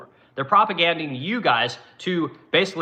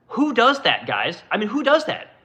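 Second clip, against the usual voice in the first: exasperated disbelief; 7 semitones higher than usual. Real, not acted. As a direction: pitch jumps up on "who does that". Outraged rhetorical question.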